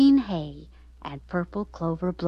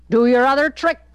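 loud, higher barked order; commanding; 5 semitones higher, 10 dB louder than usual. Acted. Circus man loudly commanding Jigsaw to do his other trick. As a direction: command it like a ringmaster. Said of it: "commanded the circus man".

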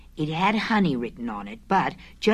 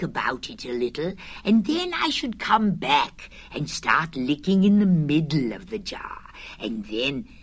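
Pooh voice; 5 semitones lower than usual. Acted. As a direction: lose yourself in the honey daydream. Pooh dreamily describing licking honey.

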